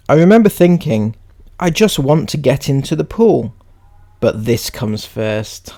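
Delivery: measured, emphatic reading voice; read-aloud. Acted. Reading someone's post to viewers, read-aloud.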